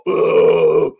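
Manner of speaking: guttural "ugh" of taking a blow; comic grunt from a gut hit; imitated winded groan. Donna taking a shoulder in the stomach, imitated groan.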